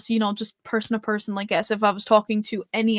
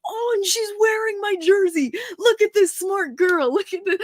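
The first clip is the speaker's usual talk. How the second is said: high, gleeful imitation of showing someone off; boisterous, happy quote, pitched way up; Johnny Boychuk, boisterous; 10 semitones higher, 3 dB louder than usual. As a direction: crow about it in a put-on high voice. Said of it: "so boisterous and happy".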